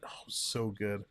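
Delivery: ordinary delivery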